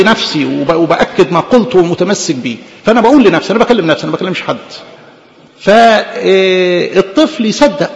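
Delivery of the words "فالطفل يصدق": slow emphasis. Real, not acted. Preacher driving home a point.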